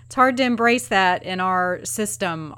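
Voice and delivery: ordinary talk, nothing marked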